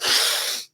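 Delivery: two hard sniffs in through the nose; breathing demo, hard double sniff; sharp nose inhale. Shown as a demonstration. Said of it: "So it's like".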